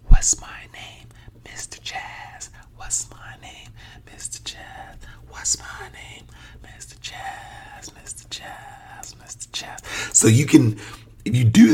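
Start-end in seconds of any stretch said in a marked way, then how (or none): 0.1-10.1 slow, voiceless demonstration whisper. Shown as a demonstration. Teacher showing listeners how whispering feels, slow whisper. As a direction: whisper it slowly, no vibration in the throat. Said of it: "Now let's whisper it".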